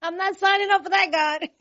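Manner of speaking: joking high-pitched refusal; high amused refusal. Real, not acted. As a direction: refuse God playfully. Joking reply to God.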